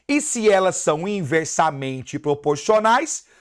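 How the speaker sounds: ordinary talk, nothing marked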